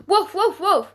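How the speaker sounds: high, loud voiced woofs; Trotsky the dog calls to the cats, barking woofs. A narrator's reading. Dog barking to the cats, high spoken woofs.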